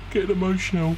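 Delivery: high-pitched pretend choking-up; mock emotional. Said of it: "I don't know why I'm getting emotional".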